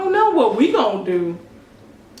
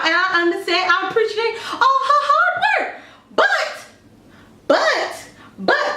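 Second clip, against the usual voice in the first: rising squeal; 11 semitones higher than usual. Real, not acted. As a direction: voice climbs to a squeaky peak.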